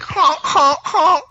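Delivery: high, loud honking goose noise. Retold or imitated. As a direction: honk like a goose for a laugh. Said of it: "I was geese".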